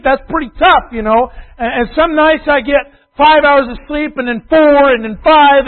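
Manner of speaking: loud, driving preacher's build, half the congregation's excuses voiced; preaching at full force. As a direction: pour it out from the pulpit.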